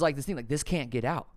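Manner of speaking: usual speaking voice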